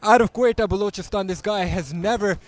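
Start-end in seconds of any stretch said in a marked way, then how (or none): none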